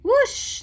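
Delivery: high, loud rising whoosh; loud whoosh as the marks rush out; whooshing sound. Acted. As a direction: sweep the word up like wind rushing out. Sound effect in story.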